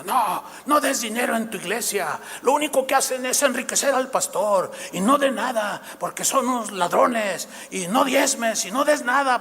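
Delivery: imitating modern Pharisees who tell people not to tithe, mocking; mocking critics. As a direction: parody the preachy critics lightly. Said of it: "se la pasan diciendo".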